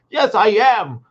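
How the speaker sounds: put-on loud, grumpy kid's reply; child snapping back at the parent; sulky child. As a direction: answer like an angry kid. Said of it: "your kid".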